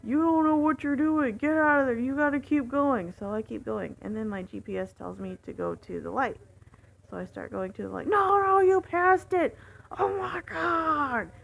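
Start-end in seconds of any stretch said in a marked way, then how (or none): 0.0-3.1 high, loud panicked yelling; frantic retold shouting; freaking out. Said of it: "freaking out". 8.1-11.3 very high, loud imitated outburst; exasperated shout, stretched at the end; freaked-out yelling. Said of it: "he's yelling at me at this point".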